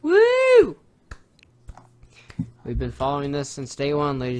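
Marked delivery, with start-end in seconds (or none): 0.0-0.8 very high, loud "woo"; high-pitched cheer; excited whoop. Real, not acted. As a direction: let out a celebratory whoop. Cheering Mars news.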